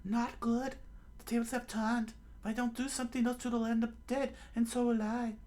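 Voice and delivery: put-on high falsetto girl voice; anxious falsetto inner monologue; Nami's worried thought. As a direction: think it through in a high, put-on female voice. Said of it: "Nami thought".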